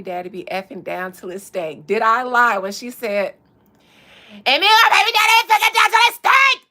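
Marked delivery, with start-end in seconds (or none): 4.3-6.6 high, loud, fast [name] quote; imitating [name]. Friend retelling a viral clip, mimicking a high loud yell. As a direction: belt it high like Cardi going off. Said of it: "because of the elevation in her voice".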